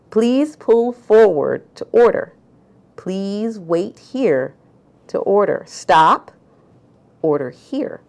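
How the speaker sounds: slow, emphatic order-taker's instructions in verse; poem performance. Acted. Poet performs poem born of a frustrating food order, poem reading. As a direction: stretch each polite command, punching the key words.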